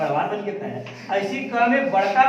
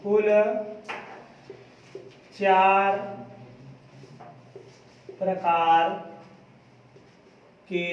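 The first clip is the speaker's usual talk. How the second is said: one word at a time, long gaps between; slow dictation; 3 semitones lower, 6 dB quieter, slower than usual. Real, not acted. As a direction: dictate at writing pace, falling softer towards the end. Teacher dictates while class writes. (एक-एक शब्द रुक-रुक कर लिखवाना)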